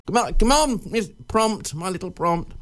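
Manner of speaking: ordinary talk, nothing marked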